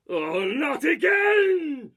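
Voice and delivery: high, loud, dismayed villain cry; villain shout. Acted. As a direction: yell it out in frustration. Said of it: "My inspiration for, for this was Rasputin".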